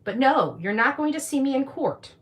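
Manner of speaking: ordinary talk, nothing marked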